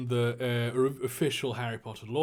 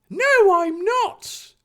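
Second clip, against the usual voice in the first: very high, loud, slow cartoon retort; brave retort; 7 dB louder, much slower than usual. Harry firing back at Draco bravely, high put-on voice. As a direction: stretch it out in a hammed-up high voice. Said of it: "Harry fired back".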